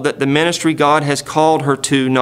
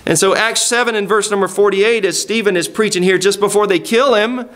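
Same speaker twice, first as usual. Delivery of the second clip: ordinary talk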